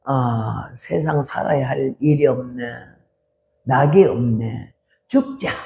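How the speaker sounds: mother hopeless about living, low and soft; despairing mother. Retold or imitated. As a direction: sigh it out like giving up on life. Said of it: "어머니가".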